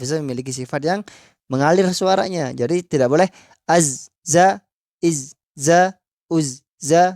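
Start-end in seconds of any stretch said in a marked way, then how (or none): 3.7-7.1 az, za split into clipped separate bits, not flowing; broken zai. Shown as a demonstration. Teacher showing the wrong, unflowing zai. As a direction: chop each syllable off short. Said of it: "itu nggak mengalir".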